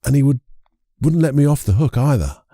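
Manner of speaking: ordinary delivery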